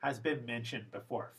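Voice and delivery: usual speaking voice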